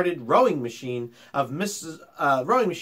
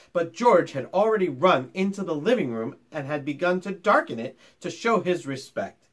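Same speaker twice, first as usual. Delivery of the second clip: ordinary delivery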